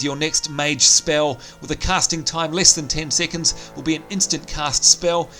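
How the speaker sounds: ordinary talk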